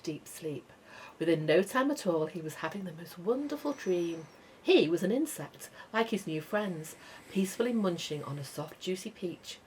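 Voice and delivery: plain reading voice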